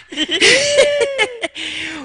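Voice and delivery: squealing high-pitched laugh; shrill cackle of laughter. Real, not acted. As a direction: crack up at your own punchline. Laughing at own joke.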